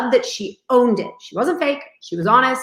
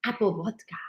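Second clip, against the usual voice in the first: whispered Russian-accented "Apple vodka"; 3 semitones lower, 11 dB quieter than usual. Shown as a demonstration. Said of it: "I can do Russian accents".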